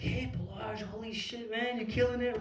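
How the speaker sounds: high, light imitation of an impressed acquaintance; Bobby. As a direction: mimic a friendly hallway greeting, pitched well up. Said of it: "He's like".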